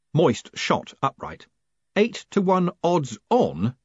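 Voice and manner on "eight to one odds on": slow, high cry of disbelief; shouted. A narrator's reading. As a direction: shout it as an appalled question. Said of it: "he shouted".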